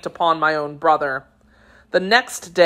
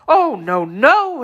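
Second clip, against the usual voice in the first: frightened protest leaping much higher; horrified exclamation; 7 semitones higher, 3 dB louder, slower than usual. A narrator's reading. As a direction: recoil in dread, the last word a high cry. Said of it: "exclaimed she".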